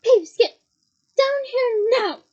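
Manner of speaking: loud, slow furious bark. Acted. McGonagall barking up at Peeves, loud and slow. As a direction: bark the order upward. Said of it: "barked Professor McGonagall".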